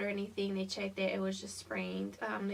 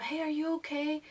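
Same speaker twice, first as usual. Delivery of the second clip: higher-pitched concerned call, retold; mildly raised mock yell; imitated calling out; 6 semitones higher, 3 dB louder than usual. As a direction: imitate a passerby calling out to check. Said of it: "I hear some guy yelling out".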